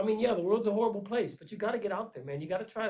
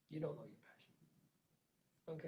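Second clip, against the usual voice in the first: much softer, slow, lower; hushed repeat; 5 semitones lower, 15 dB quieter, much slower than usual. Real, not acted. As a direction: let it sink in, almost under your breath. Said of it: "Until you can say that sentence".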